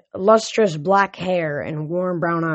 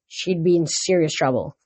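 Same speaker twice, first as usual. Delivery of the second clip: usual speaking voice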